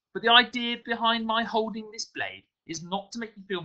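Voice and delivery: high-pitched mocking explanation; sarcastic mugger. A narrator's reading. Mugger taunting the man at knifepoint, sarcastic.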